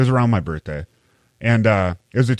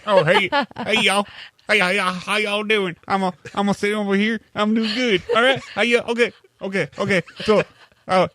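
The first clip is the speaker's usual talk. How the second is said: high, put-on Southern accent; a hammed-up Southern good ol' boy; Southern drawl; 10 semitones higher than usual. Brother greeting the podcast in a joking Southern accent. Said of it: "Are you gonna talk in that voice the whole time?".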